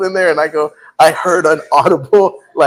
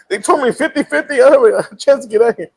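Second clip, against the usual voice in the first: excuse to the Lord; 11 semitones higher than usual. Retold or imitated. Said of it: "giving the Lord excuse".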